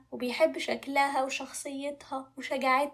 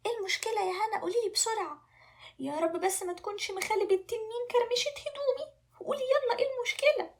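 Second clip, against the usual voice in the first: high-pitched, anxious pleading; fretting prince; 9 semitones higher than usual. Acted. Vain prince fears his clothes are creased, fretting high. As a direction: fret ever higher, demanding an answer.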